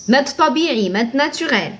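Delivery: usual speaking voice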